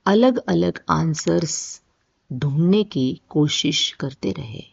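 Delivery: ordinary talk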